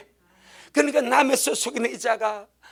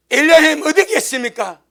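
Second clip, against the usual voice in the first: louder cry, pitched up on 어디; 4 semitones higher, 6 dB louder than usual. Retold or imitated. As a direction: call out 어디 to the sky. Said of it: "뭘 합니까".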